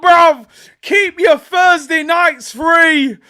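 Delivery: high, loud, slow gloating yell; ecstatic shout. Real, not acted. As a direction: crow over the equaliser at full volume. Celebrating the equaliser live.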